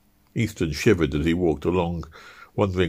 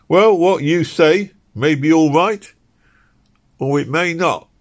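loud, high gruff constable voice; a stern London bobby challenging him; london policeman; 11 semitones higher, 7 dB louder than usual. Acted. Policeman voice in story.

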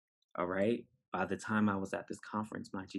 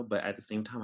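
usual speaking voice

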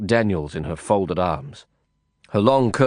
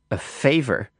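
sceptical; 3 semitones higher, slower than usual. A narrator's reading. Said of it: "as if the word was a euphemism".

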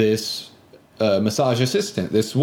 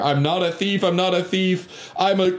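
higher, half-hearted yell; retold yelling; 7 semitones higher than usual. Man with a knife yelling at her, half-hearted. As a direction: lightly imitate a yelled denial. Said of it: "yelling at her".

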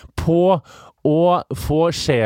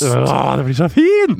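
gushing; 5 dB louder, slower than usual. Acted. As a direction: gush over how lovely it'll be. Teasing friend, gushing.

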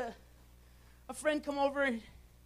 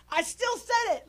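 laughing; 9 semitones higher, 4 dB louder than usual. Real, not acted. Laughing at own story.

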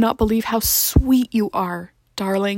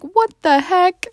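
higher, slow amazed what; retold surprise; 10 semitones higher, slower than usual. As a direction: act out the stunned parents. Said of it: "we were like".

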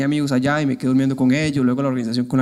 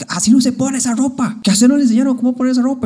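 higher, louder, fast scolding in a put-on voice; imitated scolding shout; 9 semitones higher, 5 dB louder than usual. Imitating the host lady yelling about hanging clothes, higher and louder. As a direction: bark it like an irritated older host. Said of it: "me grita".

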